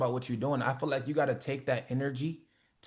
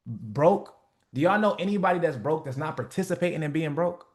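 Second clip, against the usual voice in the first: normal talking voice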